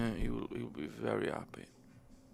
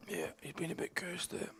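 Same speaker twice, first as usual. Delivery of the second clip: hushed whisper aside; breathy commentary whisper; 7 semitones higher, 7 dB quieter than usual. Real, not acted.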